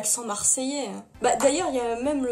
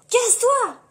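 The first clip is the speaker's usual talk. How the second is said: mock shout; 9 semitones higher, 6 dB louder than usual. Shooing someone off playfully.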